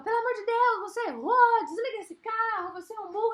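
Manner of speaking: loud, high-pitched mock tantrum; hysterical outburst. GPS berating the driver who missed the turn, hysterical tantrum. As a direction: yell it like a GPS throwing a fit. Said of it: "Ele não dá um chilique".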